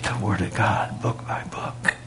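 hushed whispered aside. Acted. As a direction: drop to a breathy hush for effect. Preacher drops voice mid-point.

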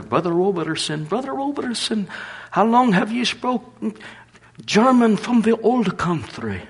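high, eager voice of an excited churchgoer; excited woman. Retold or imitated. Woman rushing to the preacher, high and excited. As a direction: rush over calling his name, high and thrilled.

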